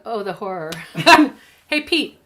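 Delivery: normal talking voice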